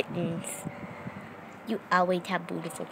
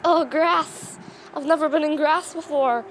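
lightly voiced excited pet, higher pitch; imagined pet's excitement; 6 semitones higher, 3 dB louder than usual. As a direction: play a pet marveling at something new. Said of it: "she looks so excited".

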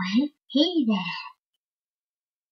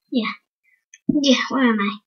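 ordinary delivery

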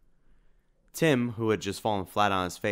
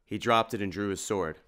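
usual speaking voice

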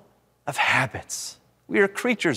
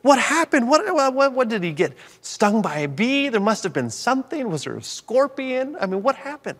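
frantic, much higher voice, racing through worries; retold panic over a screaming toddler; panicked thoughts; 8 semitones higher than usual. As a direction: spiral into a dad's alarm. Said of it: "and I'm thinking".

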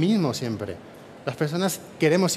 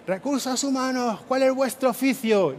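high, pitched-up war-leader call; Leónidas battle shout; 7 semitones higher, 4 dB quieter than usual. Pretend. Speaker to the HR audience as Leónidas, a mock battle cry. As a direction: summon your Spartan army with one cry. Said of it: "voy a hacer eso de".

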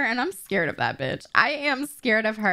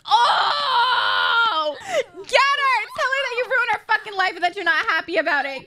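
cheering shout; 11 semitones higher than usual. Real, not acted. Streamer cheering Octavia beating Rosanna, shouting high. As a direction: hype up the fighter like a ringside fan.

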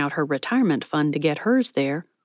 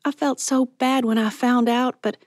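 higher, earnest voice, gathering speed; apologetic younger friend; 6 semitones higher than usual. Acted. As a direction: pour out a heartfelt apology. Voices Mallory apologising to Patty.

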